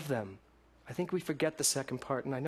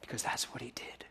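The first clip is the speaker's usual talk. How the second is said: soft, breathless whisper; 3 semitones lower, 8 dB quieter than usual. Real, not acted. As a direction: let it fall to a hush. Hushed close after litany.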